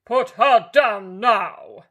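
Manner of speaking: loud slow bellow; a woodcutter commanding the wolf; loud booming command. A narrator's reading. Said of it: "bellowed the woodcutter".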